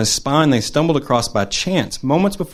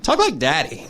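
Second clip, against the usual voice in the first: put-on higher little girl begging; child's voice; 6 semitones higher than usual. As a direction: voice a five-year-old asking eagerly. Five-year-old niece asking.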